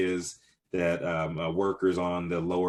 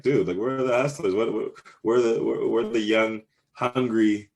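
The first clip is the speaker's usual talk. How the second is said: louder, higher exasperated complaint; mock grumpy rant; 4 semitones higher, 5 dB louder than usual. Small business owner griping about missing young hustlers, mock grumpy. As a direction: lightly play the grumbling old-timer. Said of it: "I hate sounding like a grumpy old man".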